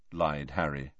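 ordinary talk, nothing marked

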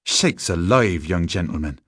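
ordinary delivery, nothing marked

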